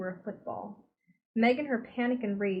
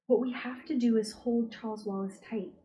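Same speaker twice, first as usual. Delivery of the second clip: ordinary delivery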